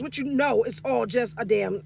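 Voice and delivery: ordinary talk, nothing marked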